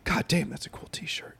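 hushed whisper of a clerk. Retold or imitated. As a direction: whisper it as if sharing a secret. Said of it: "and he goes".

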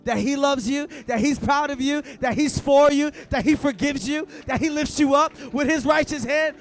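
full-force preaching. Acted. Pastor preaching God's love to the church, full-force shouting. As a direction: lift the room with it.

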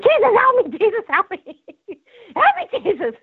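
high, pleading cry to Jesus with a nervous laugh; desperate cry for help. Retold or imitated. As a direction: beg Jesus for help, half laughing at the memory. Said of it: "And, and I'm like".

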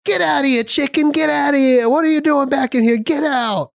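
high, loud mock cook yelling; shouting cook. Cook chasing the cat Chicken out of the kitchen, high loud yell. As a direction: shoo the cat out like the angry cook. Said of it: "He goes".

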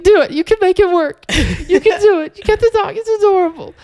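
very high, loud cheerleading shriek; hammed-up mom egging you on; over-eager mom. Mom urging an impulse dog adoption, shrieking encouragement. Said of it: "my mom is the worst person to call with an impulse decision".

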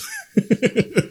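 high nervous giggle; nervous laugh. Real, not acted. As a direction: laugh it off, uneasy. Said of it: "Your giant fucking grin and laughter".

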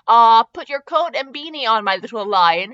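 usual speaking voice

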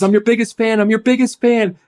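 put-on a beaming seven-year-old; excited little boy. Seven-year-old fan meets his hero, high excited child voice. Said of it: "And he goes".

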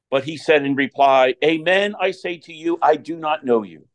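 solemn proclaimed scripture reading; Gospel reading. Acted. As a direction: read it out like a lector at Mass.

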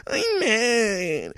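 mock crying.

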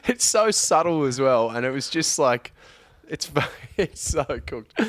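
high, amused, breaking into laughter; cracking up; laughing while talking. Real, not acted. Cracking up at his own story.

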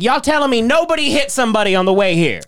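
high-pitched incredulous challenge; comic indignant disbelief; mock incredulous. Acting out defendant's incredulous challenge to courtroom.

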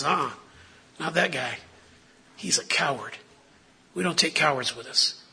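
a hint of a stern apostle brushing Mark off; Paul refusing. Paul telling Barnabas no to the coward, low and soft.

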